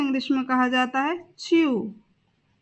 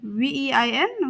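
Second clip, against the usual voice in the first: spelled out; slower than usual. Shown as a demonstration. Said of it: "शरीर की नस को कहते हैं वेन".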